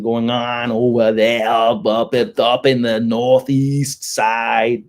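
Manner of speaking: drawn-out r-dropping Northeast accent; East Coast accent. Shown as a demonstration. Explaining the Northeast side in its own mock accent. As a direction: slide into a stretched Northeast accent. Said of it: "They have a yeah kind of thing".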